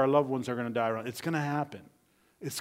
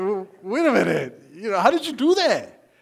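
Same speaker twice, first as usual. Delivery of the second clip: loud, raised-pitch acted disbelief; blown away; 6 semitones higher, 8 dB louder than usual. Disciples questioning Jesus after the storm, blown away. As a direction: stammer out amazement, demanding an explanation. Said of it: "They, they're blown away".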